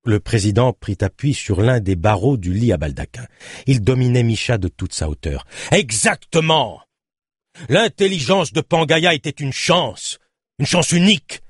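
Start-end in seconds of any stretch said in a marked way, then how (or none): 5.7-11.4 voix haute, lente et appuyée; martèle-le avec une ferveur emportée; exaltation véhémente. A narrator's reading. Le président domine Micha, exalté. (véhément, exalté)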